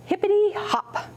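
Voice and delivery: high springy hippity hop; bouncy hop call. Acted. Storyteller voicing the rabbit's jump, high and bouncy.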